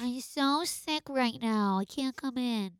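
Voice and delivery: soft, slow, pitiful fake-sick croak; milk it like you're faking a sick day. Calling the boss to fake a sick day, soft and slow. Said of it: "That's like Ferris Bueller's Day Off".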